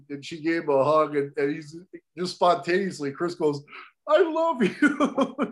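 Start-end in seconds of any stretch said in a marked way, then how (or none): 4.1-5.4 high, drawn-out mock-weepy declaration; imitated emotional breakdown. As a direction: blurt it slowly, high, breaking into a laugh. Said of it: "he just breaks down".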